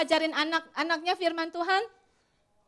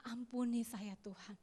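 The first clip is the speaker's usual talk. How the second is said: much softer, a quiet plea; hushed prayer; 3 semitones lower, 12 dB quieter than usual. Retold or imitated. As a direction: murmur the repentance under the breath. Retold mother repents to God.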